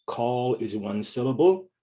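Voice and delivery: usual speaking voice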